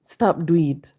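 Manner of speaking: usual speaking voice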